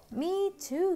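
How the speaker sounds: high, squeaky little mouse agreeing; Mouse's voice. Acted. Reading to kids, Mouse chimes in with a high squeak. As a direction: pitch it up high for the tiny mouse. Mouse answers Bird in story.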